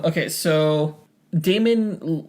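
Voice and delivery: ordinary delivery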